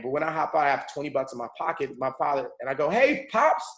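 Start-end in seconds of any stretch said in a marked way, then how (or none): 2.9-3.6 high, loud retold greeting; calling out. Son hailing his long-lost homeless father, calling out. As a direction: call out to Dad across the street.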